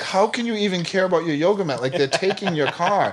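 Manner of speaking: a hint of a baffled coworker, half laughing; amazed coworker. Coworker reacts to yoga mat.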